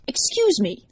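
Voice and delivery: high, loud teacherly reprimand; snapped. A narrator's reading. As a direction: cut in like an indignant teacher. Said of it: "the teacher snapped".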